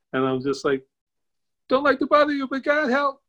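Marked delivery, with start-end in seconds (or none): 1.7-3.2 very high, squeaky panicked prayer; mock desperate plea. Man hit by a car praying at the overpass, comic panicked plea. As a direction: send up a small high cry for help.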